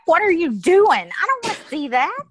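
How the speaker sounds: high, loud mock wail of protest; mock whiny protest. Mother playfully refusing her daughter's sad book, loud mock whine. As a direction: wail like the sad book is torture, half joking.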